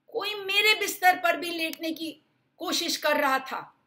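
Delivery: a high, loud mama bear cry; mama bear shouting. Acted. Mama bear shouting about her bed. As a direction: shout it, alarmed. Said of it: "मम्मा भालू चिल्लाई".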